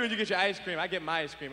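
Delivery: ordinary delivery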